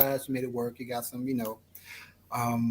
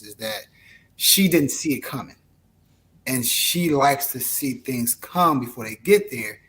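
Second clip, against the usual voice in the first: ordinary talk, nothing marked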